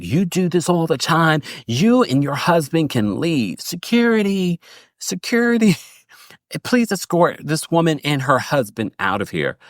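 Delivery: high, hammed-up outraged hostess ordering security; mock imperious hostess. Host at her party orders security to remove a guest, high hammed-up outrage. Meredith throwing Angie out, retold.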